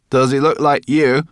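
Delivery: high, loud creature question; loud, high-voiced little brownie; Sorrel, cheeky. A narrator's reading. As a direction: pipe it up boldly.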